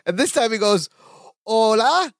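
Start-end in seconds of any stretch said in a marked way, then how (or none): 1.5-2.1 higher, hammed-up Mexican accent. Co-host imitates Larry answering the door, Mexican accent. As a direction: call out with a mock Mexican accent. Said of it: "in a Mexican accent".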